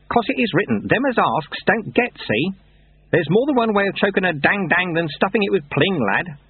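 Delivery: high-pitched, knowing mentor; sly Lutze. Acted. Lutze explaining his ruse to Lobsang, sly. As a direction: share the trick with a pupil.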